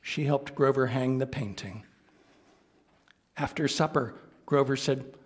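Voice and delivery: ordinary delivery, nothing marked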